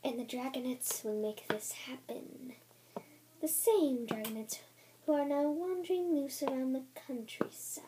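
soft, voiceless scheming whisper; Blister. Acted. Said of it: "this is Blister's voice".

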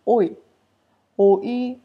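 oi said as one quick gliding syllable, repeated for clarity; Shonai word oi. Shown as a demonstration. Teacher showing viewers the dialect oi, clear demo. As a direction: drill oi twice like a teacher. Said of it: "in Shonai dialect, then that would be".

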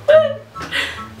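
a burst of high-pitched laughter. Real, not acted. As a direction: crack up at your own joke. Laughs at her brow peak.

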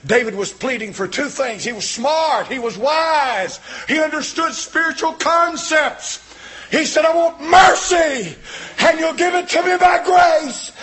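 loud, high, shouting sermon; preaching at full force. Real, not acted. Preacher telling the congregation about David, roaring full-force preaching.

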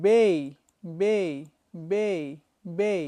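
বে said as bei with an ei glide; বে spoken with Bangla এই ending; bay with ei. Shown as a demonstration. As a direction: end বে on a clear ei. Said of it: "it should be বে".

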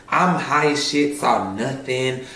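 usual speaking voice

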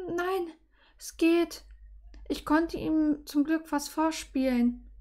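high, small voice after crying; light, high voice recovering from tears; tearful heroine. Acted. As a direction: reassure him in a high, fragile voice. Tearful heroine answers after sniffling.

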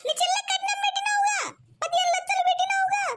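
high furious yelling at the husband; angry shout. Acted. Wife yelling at husband about his sister's dowry, furious shout. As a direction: yell it, outraged over the dowry money.

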